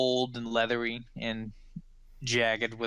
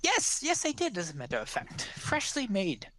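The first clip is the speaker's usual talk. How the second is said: put-on eager old woman; 7 semitones higher than usual. Old woman confirms the beef pie to the gnome, high eager voice. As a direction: pounce on the question like a delighted granny.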